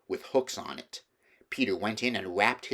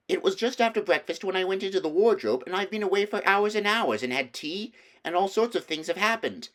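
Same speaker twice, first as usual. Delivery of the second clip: put-on higher, earnest little girl's voice; a girl pleading to be believed; eager young Lucy; 10 semitones higher than usual. A narrator's reading. Lucy insists on her story.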